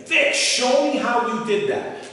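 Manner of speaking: lightly voiced child nagging his uncle; begging kid. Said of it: "I'm begging him".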